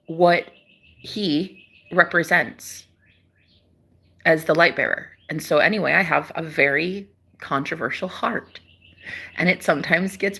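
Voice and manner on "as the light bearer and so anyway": ordinary talk, nothing marked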